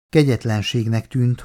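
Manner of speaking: plain reading voice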